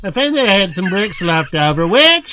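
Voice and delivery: broad Aussie working bloke telling his tale; Australian accent. Acted. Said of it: "is an Aussie bricklayer".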